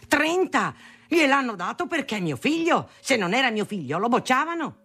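high-pitched indignant outburst; getting furious. A narrator's reading. Father learning of son's exam mark, furious protest. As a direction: erupt high, outraged by the good news. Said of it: "lui si infuriava".

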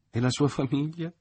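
mother trying not to laugh; holding back laughter. A narrator's reading. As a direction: barely keep the giggle in. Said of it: "trattenendosi a stento dal ridere".

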